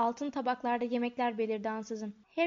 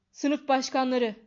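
slow, much louder call over the hall; booming command; 8 dB louder, slower than usual. A narrator's reading. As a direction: thunder it to restore order. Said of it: "diye gürledi".

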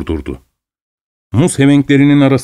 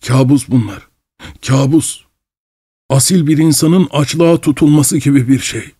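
crying; 4 semitones higher than usual. Acted. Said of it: "Sonra ağlayarak".

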